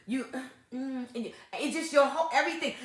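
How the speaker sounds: normal talking voice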